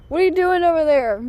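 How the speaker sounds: loud high call. Real, not acted. Calling out to a horse over there. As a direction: call it across the paddock.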